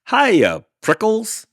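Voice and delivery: high, loud teasing hello; cheery shout. Acted. Jackrabbit shouting a nickname at Hank. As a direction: holler it as you dash by. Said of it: "she shouted".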